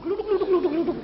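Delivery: high rolling gluduk gluduk; rumbling thunder imitation. (tiruan suara guntur)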